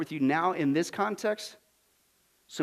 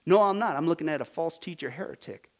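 ordinary talk

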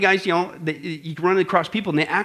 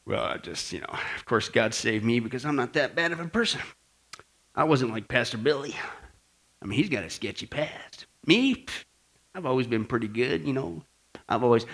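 soft, hushed self-satisfied confiding; smug self-righteous; 4 semitones lower, 6 dB quieter than usual. Retold or imitated. Pastor mocking a smug believer who thinks himself good, hushed smug tone. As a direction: pat yourself on the back quietly.

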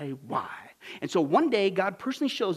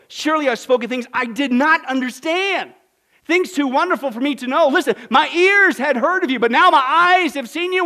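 loud, high, impassioned scripture reading; Job's response; 6 semitones higher, 9 dB louder than usual. Acted. Preacher reads Job's answer to God, impassioned. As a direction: declaim it with full preaching force. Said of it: "Listen to Job".

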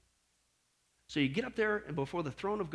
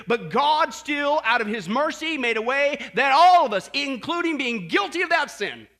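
louder and higher, building to a shout; preaching at full force; 5 semitones higher, 9 dB louder than usual. Real, not acted. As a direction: proclaim God's mercy with power. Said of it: "preaching climax".

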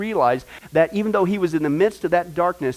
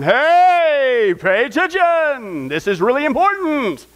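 loud, higher call to attention; scripture calling out; 7 semitones higher, 8 dB louder, much slower than usual. Acted. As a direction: shout it so nobody misses it.

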